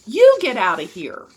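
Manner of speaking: loud retort, stress on YOU; forceful comeback at a heckler; angry yell back. A narrator's reading. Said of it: "He whirled around and yelled".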